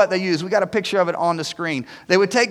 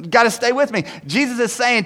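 ordinary delivery

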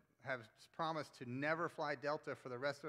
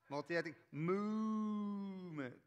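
very slow, higher, sweeping movement; moving voice; 5 semitones higher, 3 dB louder, much slower than usual. Shown as a demonstration. Pastor repeats the sweeping multi-ethnic movement, slower and bigger. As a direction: stretch it out and swing it. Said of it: "we gotta move our voices".

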